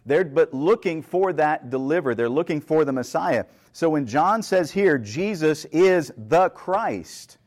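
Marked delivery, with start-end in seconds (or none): 5.0-7.4 "Jesus is the Christ" drawn out very slowly, stress on "is"; very emphatic. Retold or imitated. Preacher quoting John's declaration to the congregation, very emphatic. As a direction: land hard on "is", spacing every word. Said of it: "he is saying something very emphatic".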